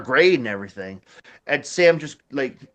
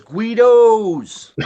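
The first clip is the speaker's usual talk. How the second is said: loud, high, drawn-out holler; imitated taunting yell; 7 semitones higher, 8 dB louder than usual. Sam taunts guys driving by, long mock yell. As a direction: holler it at a passing car. Friend yells insult at passing car.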